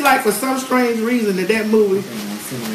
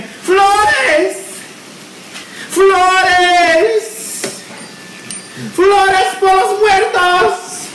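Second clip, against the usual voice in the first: imitated screaming street seller; 11 semitones higher, much slower than usual. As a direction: wail it down the alley like a hawker, higher each time. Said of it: "just screaming".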